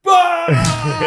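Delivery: loud, high shouted guffaw imitation; mock burst of laughter. Stalin laughing at mocked Churchill, hammed-up loud guffaw. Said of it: "And he just laughs".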